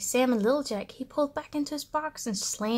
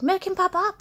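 eager cry; 7 semitones higher, 3 dB louder than usual. A narrator's reading. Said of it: "she cried".

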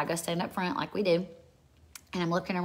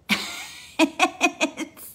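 high, loud breathless laughter; 12 semitones higher, 5 dB louder than usual. Real, not acted. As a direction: burst out laughing. Laughs at casting demons out.

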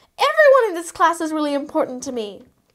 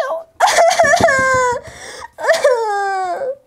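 wailing cry; 5 semitones higher than usual. Acted. Cindy breaks down crying.

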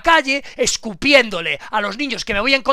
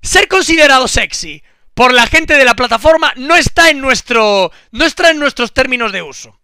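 mock stern warning; 4 semitones higher, 10 dB louder than usual.

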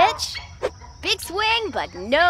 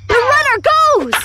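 loud, high call of the play; excited shout; 9 semitones higher, 6 dB louder than usual. Acted. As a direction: yell it out like a thrilled announcer. Announcer calls a steal.

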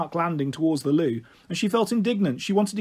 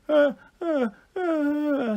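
high little grizzling noises; toddler's wordless cries; 9 semitones higher, much slower than usual. A narrator's reading. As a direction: make a small child's high whine. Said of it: "said Lily".

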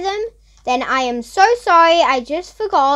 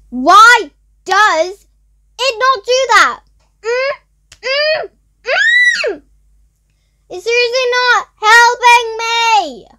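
exasperated; 6 semitones higher, 4 dB louder, slower than usual. Real, not acted. Task not working.

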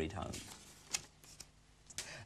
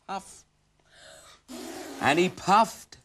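building huff and puff; 12 semitones higher, slower than usual. Acted. Narrator acts the huffing.

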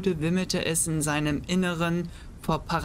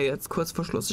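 usual speaking voice

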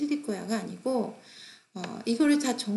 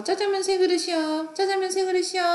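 high, loud restaurant order call; 8 semitones higher, 5 dB louder than usual. Shown as a demonstration. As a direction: sing out the order, raised and loud. Acting out a food order repeated back.

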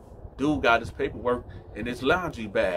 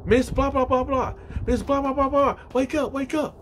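very high-pitched acted panic; frantic mock cries to an unconscious woman; urgent, squealed repeats; panicked rousing. Girl tries to rouse mother.

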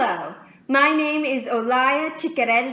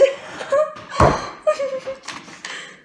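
high, breathless giggling; giddy laugh. Acted. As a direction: giggle giddily at your own fantasy. Giddy after imagined husband greeting.

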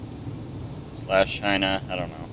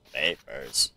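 much lower, put-on deep voice for papers; 8 semitones lower, 8 dB quieter than usual. Gamer playing the inspector, deep mock voice. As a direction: sink the voice low and heavy. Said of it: "I'll just do a deep voice".

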